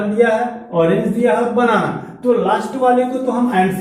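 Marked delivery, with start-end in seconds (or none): none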